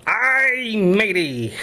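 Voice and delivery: slow, drawn-out pirate line. Acted. Punchline to his joke partner in a mock pirate voice. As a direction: ham it up like an old pirate. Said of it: "What did the pirate say".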